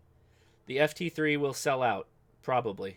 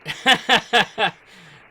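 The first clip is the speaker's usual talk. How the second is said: loud laugh; 8 semitones higher, 10 dB louder than usual. Real, not acted. Laughs at station gone.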